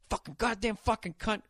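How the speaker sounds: ordinary delivery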